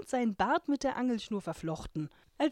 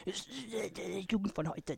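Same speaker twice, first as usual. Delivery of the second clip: put-on grumpy old man; 7 dB quieter, much slower than usual. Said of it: "so ein motziger Opa".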